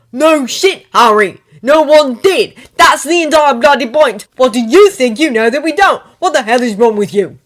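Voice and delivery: loud, high furious yelling; shouting back. Acted. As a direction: roar it back at him. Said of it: "Ron shouted back".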